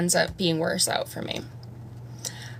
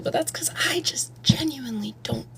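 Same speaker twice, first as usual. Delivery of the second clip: whispered trailing admission; 3 semitones higher, 6 dB quieter than usual. Real, not acted. Admitting to viewers she's lost, whispering. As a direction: drop to a soft whisper.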